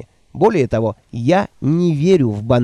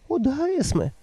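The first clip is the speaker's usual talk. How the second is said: put-on higher, timid old woman voice; meek old woman; 12 semitones higher, 5 dB quieter than usual. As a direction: answer meekly, eager to please. Nanny voiced by narrator.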